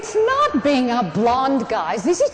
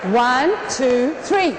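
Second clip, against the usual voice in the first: ordinary talk, nothing marked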